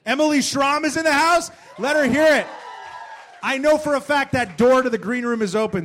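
loud, high crowd-pumping intro; booming hype-man welcome; hype announcer. Acted. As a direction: sell the guest to the whole room. Host hypes guest to live crowd.

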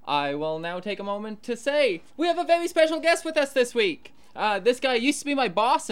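ordinary talk, nothing marked

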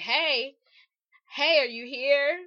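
retold insistent calling; mock pestering. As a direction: call out as if nagging for a reply. Retells suitor pestering for a reply.

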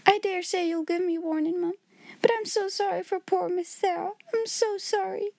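high, tearful pleading; a servant girl crying for her mistress; tearful maid. A narrator's reading. Becky crying for Sarah.

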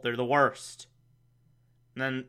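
normal talking voice

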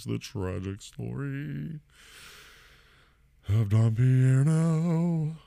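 slow mock-dramatic title read; trailer narrator. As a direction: announce it like a tragic movie trailer, very slow.

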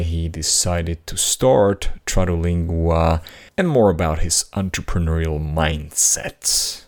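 upbeat host introducing the episode; podcast intro. Acted. Host opening the episode, bright announcer read. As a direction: announce the guest like a show opener.

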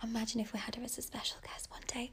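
hushed dreamy whisper. Real, not acted. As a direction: whisper the wish. Dreamy wish about celebrity guest.

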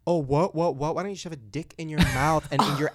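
loud, aggressive retort acted out; taunting, loud mock rant; mock abusive tirade. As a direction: imitate a bully laying into someone loudly. Acting out Farrah's abusive tirade.

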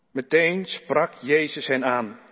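ordinary talk

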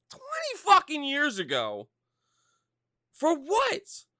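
high, loud, drawn-out disbelief; incredulous outrage. Real, not acted. Ranting about remaking Jordan's old movie, incredulous outrage. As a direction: stretch it out high in exasperation.

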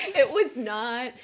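slow laughing denial. Real, not acted. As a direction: chuckle through it. Mom laughing about movies.

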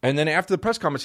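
normal talking voice

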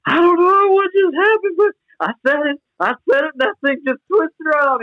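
overwhelmed, near tears in awe; choked up. Real, not acted. Calling his buddy after the miracle, voice breaking.

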